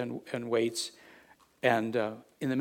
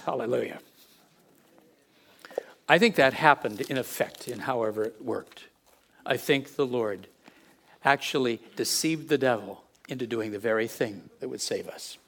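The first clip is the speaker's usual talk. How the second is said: usual speaking voice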